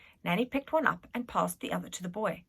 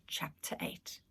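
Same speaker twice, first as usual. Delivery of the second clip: whispered aside; 11 dB quieter, slower than usual. Real, not acted. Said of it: "let's just read it anyway".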